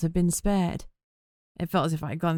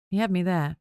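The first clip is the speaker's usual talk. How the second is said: ordinary delivery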